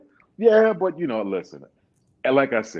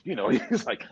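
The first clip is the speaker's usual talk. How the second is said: ordinary delivery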